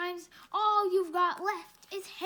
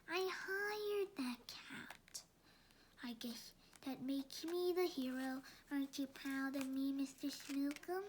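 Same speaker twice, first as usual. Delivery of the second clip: whisper; 5 semitones lower, 12 dB quieter than usual. Acted. Villain's sly aside to cat.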